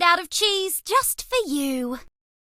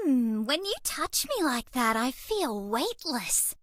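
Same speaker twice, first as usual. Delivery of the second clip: dreamy; 6 semitones lower, 7 dB quieter than usual. Acted. Dreamy from being touched.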